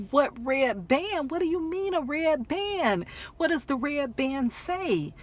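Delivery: high-pitched, bewildered demand for answers; panicked questioning. Retold or imitated. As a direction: press for an answer, pitch climbing.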